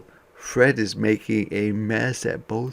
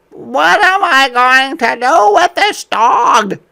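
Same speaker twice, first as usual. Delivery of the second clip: much higher and louder, fed-up mother; exasperated mom; 13 dB louder than usual. Acted. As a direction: throw up your hands as the mom. Mom voiced in story.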